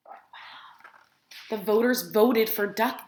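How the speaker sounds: ordinary delivery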